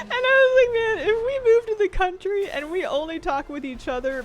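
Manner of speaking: laughing. Real, not acted. Streamer joking about their marital accent, laughing helplessly. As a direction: squeal it out while cracking up. Laughing while telling her own joke.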